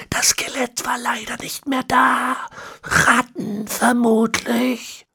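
put-on high, slow, whispery old man; ancient villain. A narrator's reading. Ezekiel explaining the horse bones, high and whispery. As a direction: rasp out the words like a centenarian.

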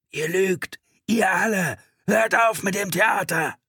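high, loud hysterical shouting; crazed, frightened. A narrator's reading. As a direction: scream accusations in panic. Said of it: "verzerrt von Angst und Wahnsinn".

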